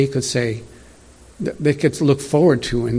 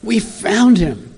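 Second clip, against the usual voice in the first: higher and slow, savouring the news; excited, drawn out; 9 semitones higher, 3 dB louder, much slower than usual. Acted. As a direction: stretch out the thrill of finding him. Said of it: "I can hear the excitement".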